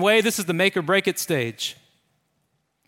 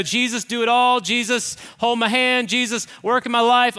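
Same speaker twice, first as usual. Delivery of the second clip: eager pious prayer; 5 semitones higher, 5 dB louder than usual. Retold or imitated. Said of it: "And that sounds easy".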